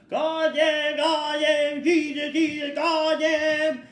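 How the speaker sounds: very high, loud Acadian French swearing; Acadian French yell. Retold or imitated. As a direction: bellow the curses in a thick Acadian French accent. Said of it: "in his Acadian French accent, he would yell".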